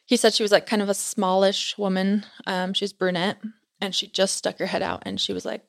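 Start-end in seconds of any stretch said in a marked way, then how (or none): none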